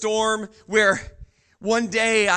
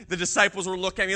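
usual speaking voice

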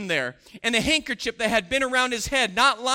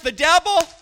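ordinary talk

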